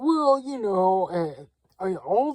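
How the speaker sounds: slow, high mock of Trump stalling; hammed-up hesitant Trump. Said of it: "And he's like".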